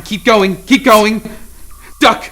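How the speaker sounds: high, loud, desperate yells; desperate shout. Acted. Controller yells at Mary dodging lasers, desperate shouts. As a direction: scream at her to keep moving. Shouting warnings over the intercom.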